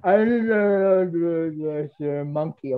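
long drawn-out mock garble; imitating a pupil. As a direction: drag out a jumbled imitation in one run. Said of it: "Somebody say".

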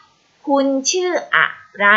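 very slow, word by word; slow model question. Shown as a demonstration. Teacher voices Daeng asking Khiao his name, slowly. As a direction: stretch out each word of the question.

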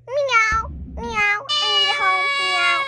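a child playing a pleading cat. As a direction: meow like a kitten begging for a home.

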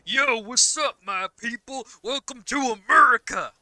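put-on loud, high, over-the-top American accent greeting; mock American accent welcoming the viewers; heavy American accent. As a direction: ham up a thick American accent. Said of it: "the heaviest American accent".

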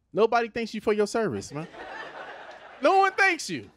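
normal talking voice

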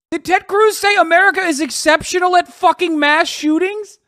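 high, loud astonishment; loud, high-pitched disbelief; incredulous outburst. Real, not acted. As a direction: react as if you can't believe it, voice way up. Astonished at Cruz's reply.

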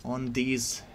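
put-on hammy Russian commander accent. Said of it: "wannabe Russian voice acting impression by me".

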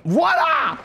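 voilà lancé très haut, triomphant; crie-le comme une victoire; voilà triomphal. Real, not acted. Célébration d'un point gagné. (voilà aigu et triomphant)

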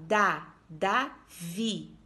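Da drawn out, then Davi, very slow and separated; slow teacher's sounding-out of Da, Davi; syllables spelled out slowly. Shown as a demonstration. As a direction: sound out Da before saying Davi. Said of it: "olha como fala".

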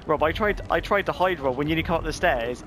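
ordinary talk

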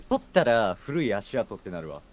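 normal talking voice

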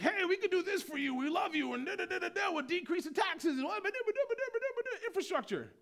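high, loud, sing-song parody; mock politician. Preacher mocking politicians' promises, high and loud. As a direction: lampoon a campaign pitch.

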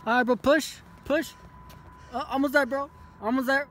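urging shout. Real, not acted. Driver urging his friend to push the stalled car. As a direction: yell encouragement at a straining friend.

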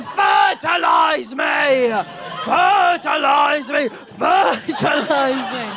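loud, long-drawn shouted plea; mock desperate wail. Begging a partner on a Zoom meeting, mock desperate wailing. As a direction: bellow it slowly, stretching each word.

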